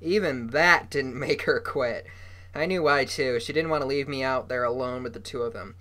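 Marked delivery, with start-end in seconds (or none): none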